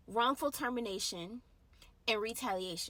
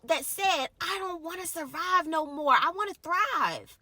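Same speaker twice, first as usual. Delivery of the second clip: preaching; 4 semitones higher, 8 dB louder than usual. Real, not acted. Preaching at full force.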